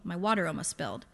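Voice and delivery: usual speaking voice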